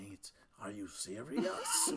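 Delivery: drawn-out British "mate", laughing underneath; British man. Retold or imitated. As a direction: imitate an incredulous UK bloke. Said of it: "this guy from the U K".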